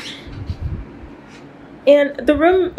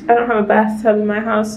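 usual speaking voice

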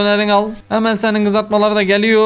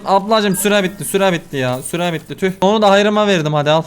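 ordinary talk

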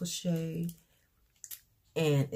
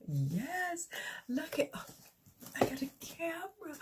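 much higher, giddy; thrilled squeal; 12 semitones higher, 5 dB quieter than usual. Real, not acted. Telling viewers about her new camera, giddy and thrilled.

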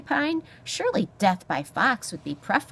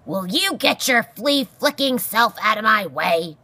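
loud, raised, impatient bellow; 4 semitones higher, 7 dB louder than usual. A narrator's reading. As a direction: bellow the order at a pest. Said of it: "Aerith bellowed".

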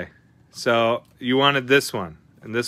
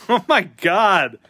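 laughing; 6 semitones higher, 6 dB louder, slower than usual. Real, not acted. Laughs at a bad pull.

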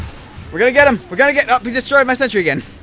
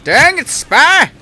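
angry shout; 4 semitones higher, 6 dB louder than usual. Real, not acted. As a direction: shout at the spy to clear off. Spy sapping his sentry.

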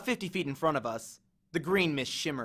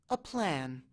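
much softer, shrinking; small voice; 7 dB quieter than usual. Acted. Annabeth echoes Grover's plan in a small, softer voice. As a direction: let the words shrink away. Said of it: "her voice sound quite so small".

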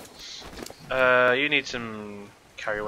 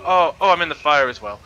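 ordinary delivery

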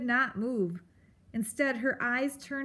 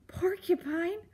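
horrified echo; 8 semitones higher than usual. A narrator's reading. Mouse horrified.

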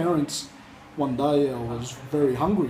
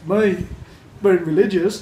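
louder, scoffing at the very idea; mocking disbelief; 5 semitones higher, 6 dB louder than usual. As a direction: laugh the notion off, incredulous. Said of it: "I pretty much laughed at him".

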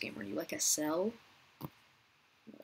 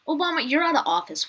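amused surprise; 8 semitones higher, 7 dB louder, faster than usual. Real, not acted. Surprised at player named Obama.

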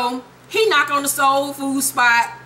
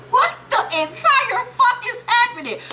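stunned disbelief; 11 semitones higher than usual. Retold or imitated. Greg stunned at suspension.

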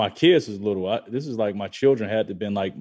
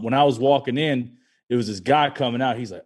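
ordinary delivery

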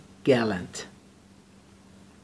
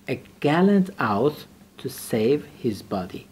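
slow reading; 3 dB louder, slower than usual. Shown as a demonstration. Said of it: "teacher reads phrase slowly".

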